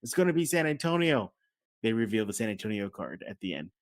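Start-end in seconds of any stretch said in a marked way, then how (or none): 0.0-1.3 high-pitched retold fan's outburst; imitated Rockets fan. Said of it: "This one guy".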